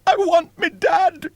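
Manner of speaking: put-on very high, loud childlike whimper; a scared boy begging for his dad; high, loud whimpered plea; whimpering child. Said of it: "whimpered William".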